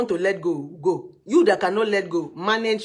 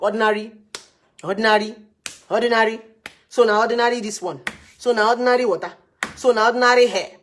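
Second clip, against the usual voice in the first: emphatic repeated word; 4 semitones higher, 7 dB louder than usual. Real, not acted. Hammering home a point.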